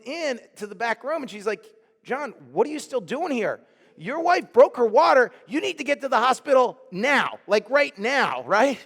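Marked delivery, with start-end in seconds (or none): 2.0-8.8 higher, louder hurried alarm; urgent scolding. Retold or imitated. Nurse Yvette telling John to get to the hospital, urgent and loud. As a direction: push him out the door urgently.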